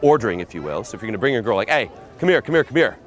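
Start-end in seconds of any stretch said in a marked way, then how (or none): 1.7-3.0 louder, higher, insistent order; commanding. Shown as a demonstration. Pickup coach demonstrating bringing a girl over, commanding. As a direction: order her over, repeating it briskly. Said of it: "one, commanding".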